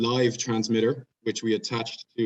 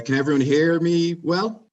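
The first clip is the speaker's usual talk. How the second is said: raised voice, pitch climbing to a question; mic check; 8 semitones higher, 8 dB louder than usual. Real, not acted. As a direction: call out high and loud to check the line. Checking the audience can hear.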